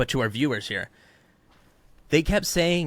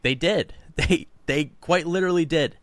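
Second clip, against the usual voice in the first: exasperated; 5 semitones higher than usual. Retold or imitated. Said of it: "I was like".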